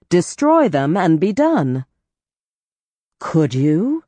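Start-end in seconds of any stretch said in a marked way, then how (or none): 3.2-4.0 disbelieving question; incredulous. A narrator's reading. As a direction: doubt he could really do it. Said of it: "Strigan asked, incredulous".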